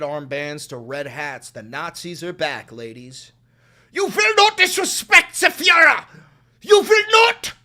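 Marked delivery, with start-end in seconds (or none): 3.9-7.5 screaming zealot, high and loud; hammed-up outraged loyalist yelling; shrieking mock fascist; mock fanatic shout. Mocking a MAGA fanatic again.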